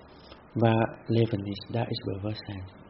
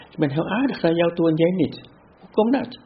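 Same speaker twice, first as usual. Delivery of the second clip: high, louder, hurt and puzzled complaint; mock indignant whine; 8 semitones higher, 5 dB louder than usual. Imitating someone who feels wronged by a friend, high indignant whine. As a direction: play the offended one asking why it's unfair.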